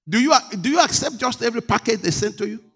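ordinary talk, nothing marked

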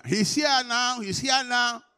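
high, loud preacher's shout; ringing, urgent cry from the pulpit; shouted proclamation. Real, not acted. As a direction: proclaim it at full force to the congregation. Preacher proclaims God's presence to congregation.

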